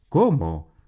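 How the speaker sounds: sudden loud startled "¿Cómo?"; startled exclamation. A narrator's reading. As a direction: jump at the news, alarmed. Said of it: "exclamó Augusto sobresaltado". («¿Cómo?» sobresaltado y fuerte)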